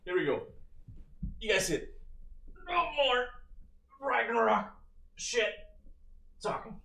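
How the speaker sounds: high, strained push-up voice; straining. Real, not acted. Streamer doing push-ups as penance, straining high. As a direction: grunt each word out mid push-up. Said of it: "doing push-ups on stream".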